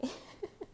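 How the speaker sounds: quick unvoiced giggle; soft breathy giggle. Real, not acted. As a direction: breathe out a little laugh. Laughs at herself.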